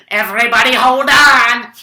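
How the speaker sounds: rally everyone with a loud call; heroic shout. Acted. Professor to the group, as he rescues them: loud heroic shout.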